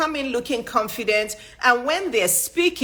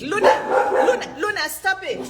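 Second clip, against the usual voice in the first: calling the dog; 9 semitones higher than usual. Real, not acted. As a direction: call Luna sharply to stop her.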